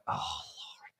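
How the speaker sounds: whispered "Oh, Lord" under the breath; whispered prayer. Retold or imitated. Praying while she hunts for the key, whispered. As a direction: breathe a desperate little prayer. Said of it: "and I'm like".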